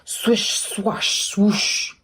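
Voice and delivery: whispered swish. Acted. Reader voicing the sweeper's brushes in a whisper.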